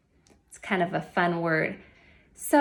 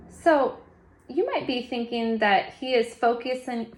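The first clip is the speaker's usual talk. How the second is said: ordinary talk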